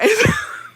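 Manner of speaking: high burst of laughter; real laugh. Real, not acted. Storyteller losing it over the confrontation, high laughing. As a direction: crack up after the setup.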